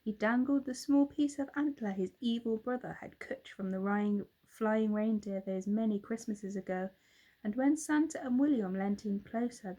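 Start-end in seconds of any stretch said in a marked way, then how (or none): none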